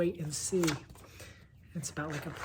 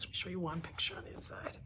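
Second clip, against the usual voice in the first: quick whisper; 10 dB quieter, faster than usual. Real, not acted. About to show inside page.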